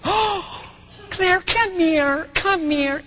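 high, slow, cooing mom voice; imitating concerned wife. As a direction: coo to a hurt little girl, pitched well up. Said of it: "What does my wife do?".

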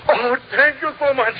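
put-on high, grateful German-accented gush; a German lady's thankful lift in pitch. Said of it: "She still had her German accent".

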